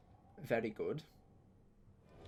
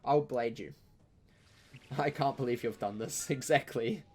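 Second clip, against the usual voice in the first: usual speaking voice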